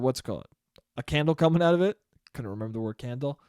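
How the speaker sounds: ordinary delivery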